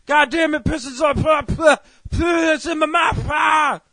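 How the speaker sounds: high, loud spluttering shout; sputtering outrage. Acted. Reviewer acting out getting pissed in the mouth, sputtering outrage. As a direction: yell through frantic spitting.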